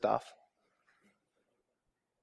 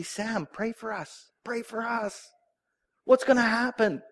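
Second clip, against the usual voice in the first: terrified plea; 8 semitones higher than usual. Retold or imitated. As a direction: beg the prophet like scared villagers. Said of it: "The people are terrified".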